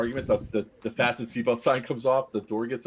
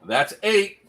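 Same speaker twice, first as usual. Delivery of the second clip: teasing callback; 7 semitones higher, 4 dB louder than usual. Retold or imitated. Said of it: "So I call back".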